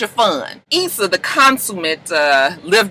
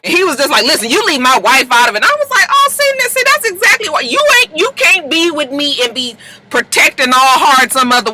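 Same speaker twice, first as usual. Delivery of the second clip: mock heated argument; 10 semitones higher, 4 dB louder than usual. [name] confronting Dro, retold.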